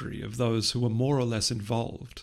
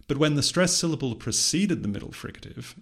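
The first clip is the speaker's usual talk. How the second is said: ordinary talk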